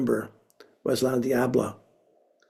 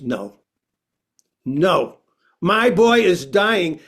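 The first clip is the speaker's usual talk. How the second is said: firm insistence; 3 semitones higher, 5 dB louder, slower than usual. Retold or imitated. La Diabla insisting firmly.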